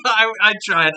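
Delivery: giggling at own flub; laughing. Real, not acted. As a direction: laugh it off sheepishly. Laughs at failed voice.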